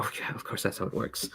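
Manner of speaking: sarcastic whisper. Real, not acted. Deadpan agreement.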